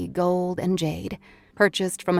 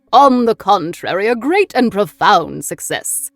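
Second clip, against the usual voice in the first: triumphant occult philosopher; 3 semitones higher, 9 dB louder than usual. A narrator's reading. John Dee refutes his visitors' concerns, loud and grandly triumphant. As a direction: proclaim it with sweeping theatrical force. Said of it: "refuting their concerns".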